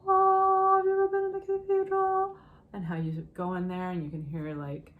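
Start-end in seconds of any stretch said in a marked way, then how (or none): 0.1-2.4 high, loud, fast playful question; teacher asks the class in a playful high voice; sing-song aside. Acted. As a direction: toss it off in a high lilt.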